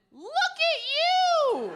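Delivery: put-on high, slow, loud baby-praising coo; mock cooing partner. Boyfriend cooing at her in bed, high slow baby-talk praise. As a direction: coo like praising a toddler's first steps. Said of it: "he just went".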